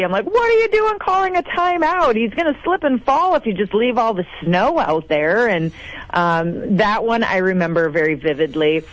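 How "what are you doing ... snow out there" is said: much higher, retold yell at the screen. Sister yelling at the team on TV, high exasperated yell. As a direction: shout the frustration at the TV. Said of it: "yelling at the T V".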